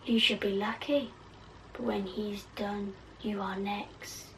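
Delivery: soft sinister Bellatrix threat; Bellatrix whisper. Acted. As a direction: hush it like a threatening witch. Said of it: "oh, this is Bellatrix".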